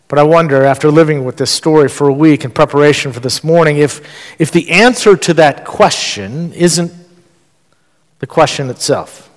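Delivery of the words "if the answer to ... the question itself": usual speaking voice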